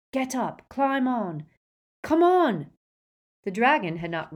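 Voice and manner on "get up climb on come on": high, building to a loud shout; urgent shouted orders. A narrator's reading. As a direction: urge them on, louder each time. Urgent orders during an escape.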